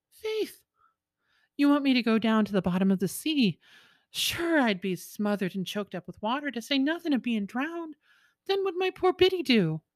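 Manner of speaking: a man picturing himself drowned; alarmed protest. Acted.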